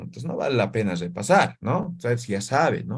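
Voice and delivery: ordinary talk, nothing marked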